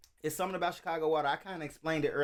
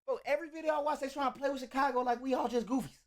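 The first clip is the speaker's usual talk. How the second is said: full-on Chicago voice; 8 semitones higher than usual. Pretend. Reactor complaining about his block being played with, in high Chicago voice. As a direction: squeal it out in a put-on Chicago voice. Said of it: "turn on my full-on Chicago voice".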